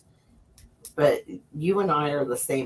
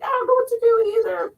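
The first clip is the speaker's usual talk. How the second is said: much higher, fast whiny voice; squeaky voice; 6 dB louder, much faster than usual. Retold or imitated. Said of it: "And you're like".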